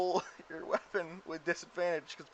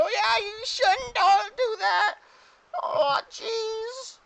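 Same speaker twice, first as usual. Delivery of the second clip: very high, slow whiny creature voice; goblin voice; 3 dB louder, much slower than usual. Acted. Goblins dogpiling Morty, high drawn-out goblin voice. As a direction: squeal it out slowly like a little goblin. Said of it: "dogpiling and go".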